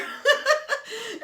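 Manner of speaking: a big, high burst of laughter; shrieking laugh at her own story; loud laugh. Real, not acted. Laughing at the memory.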